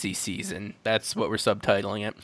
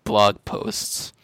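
normal talking voice

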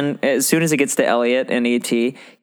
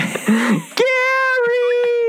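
high giggle. Real, not acted. Laughing at friend.

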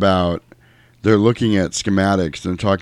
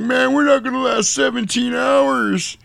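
much higher, panicky whine of a scared marine; Hudson freaking out. Retold or imitated. Hudson panicking to the squad after the crash, high and frantic. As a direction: squeal it like everything is lost. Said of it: "Hudson's freaking out again".

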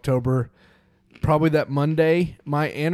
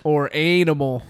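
usual speaking voice

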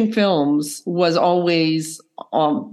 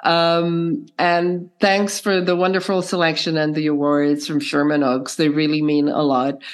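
ordinary delivery

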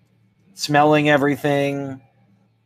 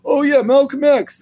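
loud, high burst of recognition; mock-enthusiastic high exclamation; imagined stranger chiming in; 6 dB louder than usual. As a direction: pipe up eagerly, pitched way up. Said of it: "if he was like".

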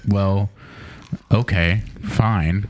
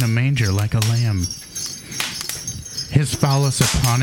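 poem recital. Acted. Comic reading a Christmas poem to co-hosts, mock-solemn recital. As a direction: recite it like a sacred holiday verse. Reads dirty poem as blessing.

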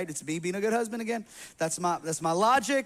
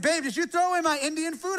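higher-pitched voice for a quoted wife; lightly voiced wife asking, pitched up; imitated wife; 8 semitones higher than usual. Wife questioning husband about Indian food, higher imitated voice. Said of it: "I was like, she said".

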